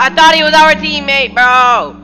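high loud exasperated yell; shouting. Real, not acted. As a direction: yell it in disbelief. Shouted repeat of mistake.

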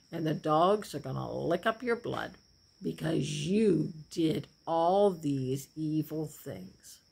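low, soft, slow solemn warning; stern prophet. Acted. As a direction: pronounce doom gravely and slowly. Prophet Elijah pronouncing judgment.

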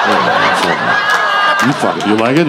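ordinary talk, nothing marked

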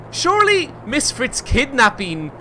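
high, slow, disbelieving; exasperated. Real, not acted. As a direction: drag it out in disbelief. Exasperated at game logic.

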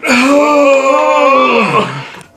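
loud, high, exaggerated breathing-in sigh; hammed-up sniff-and-sigh, as if savouring it; mock deep inhale. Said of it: "Oh, I'm gonna breathe it in".

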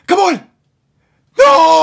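loud, high cry of despair; frustrated shout. Real, not acted. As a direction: yell at the miss. Missed chance, gamer yells.

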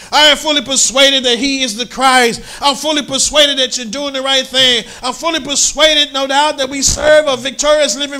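high, loud rising sermon close; climactic preaching. Acted. Preacher proclaiming to the church he is fully persuaded. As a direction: build to the peak and proclaim.

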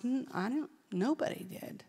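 soft, hushed admission; quiet realisation. Retold or imitated. Preacher recalls painful realisation, hushed. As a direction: let it dawn on you quietly.

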